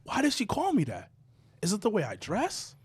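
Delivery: very soft, wounded questioning; dejected. Retold or imitated. Insulted man brooding at the bar, dejected. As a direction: brood aloud, hushed, retelling the hurt.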